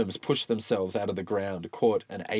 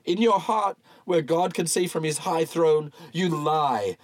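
condemning preacher; 7 semitones higher, 4 dB louder than usual. Acted. Bunyan condemns Walton.

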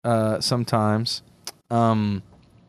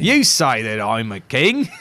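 slow, drawn-out mock British accent; a hint of a British accent, taken slowly; 4 dB louder, slower than usual. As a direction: lightly play Pilate's Jesus with a British accent. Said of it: "with British accents".

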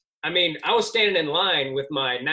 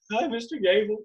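starstruck nervous; 6 semitones higher than usual. Retold or imitated. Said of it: "I'm afraid I couldn't talk".

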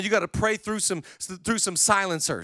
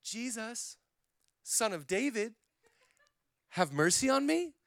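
soft, restrained plea; the timid version, barely heard; quiet plea; 8 dB quieter than usual. Shown as a demonstration. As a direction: hold back and murmur it. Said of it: "it was not cute".

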